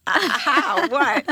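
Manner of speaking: high-pitched mock bewilderment, breaking into laughter; baffled reaction. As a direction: act out a stumped, comic "huh?". Retold baffled reaction to fintech.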